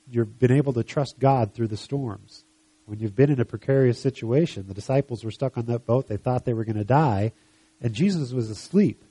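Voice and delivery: ordinary talk, nothing marked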